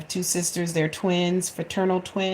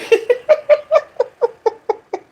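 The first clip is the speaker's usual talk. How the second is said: big laugh; 7 dB louder than usual. Retold or imitated. Son's laughing retold.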